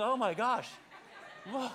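loud, high, laughing mock alarm. Pastor mocking the crowd huddled under a tarp, loud laughing mock alarm. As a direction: ham up the fuss over a little rain. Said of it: "huddling under the little tarp going".

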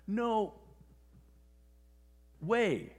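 loud, high, with a long pause between the words; acted disbelief. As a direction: imitate stunned disbelief, each word punched. Retold actor cannot believe it.

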